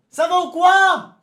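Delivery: crié aigu et fort, lent; salut crié. Real, not acted. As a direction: interpelle tout le chat en criant. Salue le chat en criant. (salue le chat en criant, aigu et fort)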